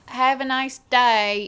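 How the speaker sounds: retry the British farewell, still lands Australian; imitating British staff saying goodbye again; mock British accent, second try. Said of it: "No, that's still Australian".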